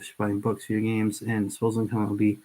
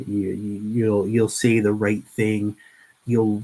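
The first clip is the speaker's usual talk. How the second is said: ordinary talk, nothing marked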